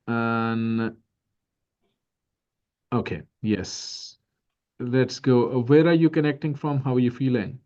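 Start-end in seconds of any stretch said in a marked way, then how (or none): none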